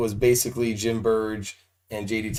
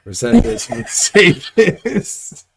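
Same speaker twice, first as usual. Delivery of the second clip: laughing; 6 semitones higher, 8 dB louder, slower than usual. Real, not acted. Interviewer laughing in disbelief.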